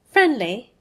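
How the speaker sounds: loud, sharp scornful echo; snapping. A narrator's reading. Malfoy scorning Harry's offer, snapping loudly. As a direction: bite the word back at him. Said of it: "Malfoy snapped now".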